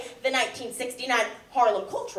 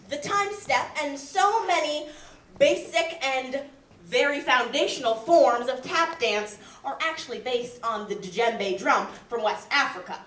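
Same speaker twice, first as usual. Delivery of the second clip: ordinary talk, nothing marked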